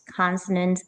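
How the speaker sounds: usual speaking voice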